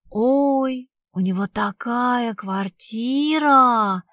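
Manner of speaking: drawn-out awestruck gush; gushing awe. Acted. Girl gushing about a rich boyfriend's flat, awestruck. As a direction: stretch the words in wide-eyed wonder.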